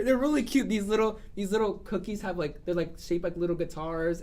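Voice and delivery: ordinary talk, nothing marked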